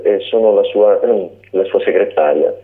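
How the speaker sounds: usual speaking voice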